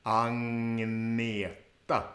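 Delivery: Agneta slow, ng sound, stress on the second syllable ag-NE-ta; Swedish Agneta. Shown as a demonstration. Teacher drilling the name for learners, slow stressed demo. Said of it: "the stress is on the second part".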